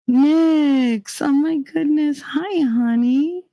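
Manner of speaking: high slow doting hi honey. Real, not acted. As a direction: coo sweetly at the cat. Cooing at a pet.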